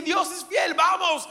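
high, loud shout urging the people on; shouted rallying cry. Retold or imitated. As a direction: bellow it like a bold call to march. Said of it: "yo vociferé".

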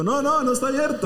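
high, emphatic no no no; mock high refusal. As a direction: playfully refuse the stranger. Negando al visitante, exagerado. (negativa aguda y exagerada)

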